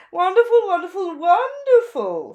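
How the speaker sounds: high, loud, gushing fashion bird; gushing Zelda. A narrator's reading. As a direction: gush over the new look like a flamboyant stylist. Zelda voiced.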